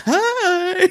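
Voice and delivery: sudden very high squeal of a greeting. Retold or imitated. Mates dancing, shrieking a high giddy hi. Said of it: "we were just all like".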